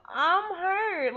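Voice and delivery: upset daughter pleading; distressed plea. Retold or imitated. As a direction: cry out "I'm hurt" to mom. Retelling her own distress to mom.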